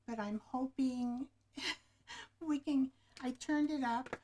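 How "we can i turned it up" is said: ordinary talk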